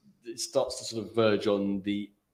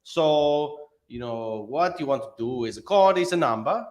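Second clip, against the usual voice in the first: put-on Italian accent; 5 dB louder than usual. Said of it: "skirting around the point like Italian".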